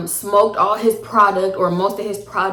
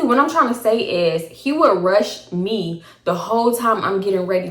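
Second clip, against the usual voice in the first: normal talking voice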